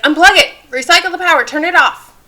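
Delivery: imitating her panicked instructions; urgent orders. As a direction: bark out the fix in a rush. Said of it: "she's like".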